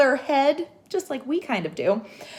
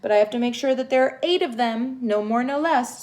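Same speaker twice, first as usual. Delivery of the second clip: normal talking voice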